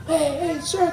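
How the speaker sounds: put-on high, soft child voice; small, high-pitched call; little boy. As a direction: imitate a small boy seeking attention. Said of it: "these two little boys".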